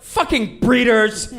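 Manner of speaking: high, loud, drawn-out outburst; mock angry shout. Writer imitating a colleague storming out, mock angry shout. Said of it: "one of us will shout".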